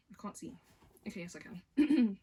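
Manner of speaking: ordinary talk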